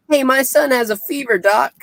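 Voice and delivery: lightly acted high-pitched call for attention. Lady calls to the doctor in town about her son, calling out. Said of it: "some lady calls out".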